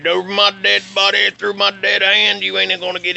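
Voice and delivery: loud, high gung-ho soldier bluster; mock-heroic military defiance, high and loud; defiant soldier. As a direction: bellow it like a tough guy daring anyone to try. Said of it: "brave military guys out there telling you".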